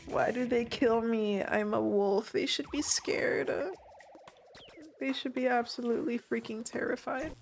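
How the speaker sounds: ordinary talk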